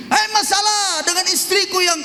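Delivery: high yelled call about the wife; mock rant; shouted complaint. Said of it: "Misalnya kan begitu". (teriakan keluhan yang tinggi)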